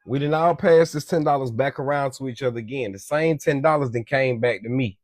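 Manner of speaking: ordinary delivery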